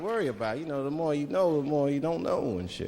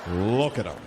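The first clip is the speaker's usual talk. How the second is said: gawk at him like a zoo exhibit; mocking onlookers; slower than usual. Racist onlookers watching him eat.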